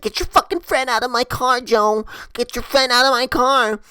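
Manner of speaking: high-pitched imitation of an angry driver; mock furious demand. Driver ordering Joe's drunk friend out, mock furious demand. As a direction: pitch it way up, outraged and insistent.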